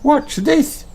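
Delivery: very high squeak; tiny bunny squeaking; squeaky Rebecca Rabbit. A narrator's reading. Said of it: "squeaks Rebecca".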